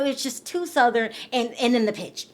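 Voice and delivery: ordinary talk, nothing marked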